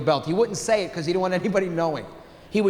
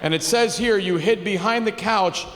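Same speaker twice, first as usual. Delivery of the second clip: skeptical cop. Retold or imitated. Skeptical cops taking a report.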